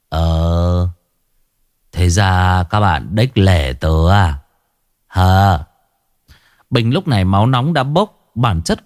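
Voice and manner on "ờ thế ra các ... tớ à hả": slow, low slurred taunt; drunk slurring. Acted. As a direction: drag the words like a drunk. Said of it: "lè nhè lằng nhằng".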